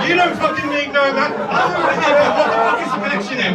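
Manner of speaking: loud, high exasperated rant; incredulous rant. Real, not acted. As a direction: rant in disbelief at the absurd advice. Comic outburst at the advice.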